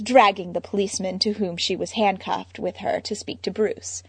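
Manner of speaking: ordinary delivery, nothing marked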